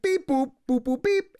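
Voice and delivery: high, loud monotone robot beeps. Retold or imitated. Nico being silly for the player, mock robot voice. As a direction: imitate a kid playing robot in high beeps. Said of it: "you should do a robot voice".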